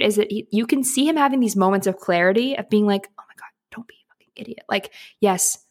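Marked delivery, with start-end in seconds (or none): 3.2-4.7 imitated inner voice, hushed whisper; quick, barely audible whispered aside; whispered self-talk. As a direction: whisper it quickly, as if scolding yourself. Voices his inner moment of clarity.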